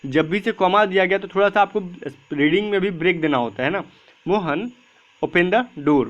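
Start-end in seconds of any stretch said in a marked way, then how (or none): 4.3-6.1 "Mohan", a small pause, then "open the door"; short gap after mohan. Shown as a demonstration. Teacher showing students the comma pause in "Mohan open the door". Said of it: "मैंने थोड़ा सा gap दिया".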